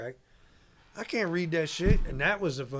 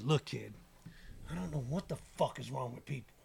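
low, soft imitation of a mentor; quiet bemusement; 3 semitones lower, 11 dB quieter than usual. Mentor reassures kid about doubters, quiet and low. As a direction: say it softly, letting the words sink in. Retells mogul's quiet, memorable advice.

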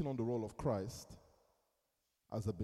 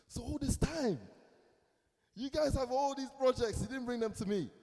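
much higher and louder, incredulous exclaim; indignant surprise; 11 semitones higher, 5 dB louder than usual. Retold or imitated. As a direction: act the shock of discovering hidden projects. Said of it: "I said".

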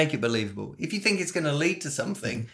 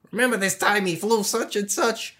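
higher-pitched pilot telling a story; fellow pilot; 8 semitones higher than usual. Acted. A pilot reminiscing at the retirement party, storytelling pilot. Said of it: "other pilots tell funny stories".

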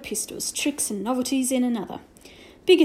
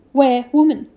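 louder, blunt Little Bear voice; a small brave demanding, louder; 8 dB louder than usual. A narrator's reading. Little Bear asks where the woman is, blunt and louder.

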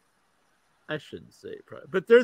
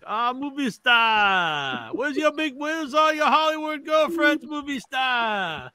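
higher-pitched put-on voice with drawn-out "movie star"; store owner impression; 7 semitones higher, 4 dB louder, slower than usual. Store owner greeting him as movie star, high caricature voice. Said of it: "He calls me movie star".

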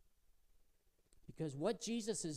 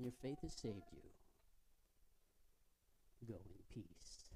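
smiling blessing; 6 semitones lower, 13 dB quieter than usual. Acted. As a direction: send her off gently, smiling. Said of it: "Jesus smiles and he says".

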